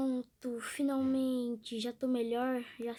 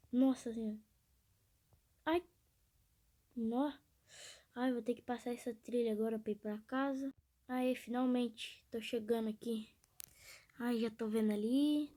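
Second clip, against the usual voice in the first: ordinary talk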